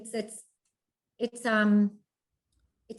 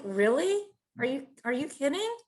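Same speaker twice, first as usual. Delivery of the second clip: raised pitch on mocking questions; incredulous; 5 semitones higher than usual. Veteran reacting to wives who wear husbands' rank, incredulous. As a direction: question it in sheer disbelief.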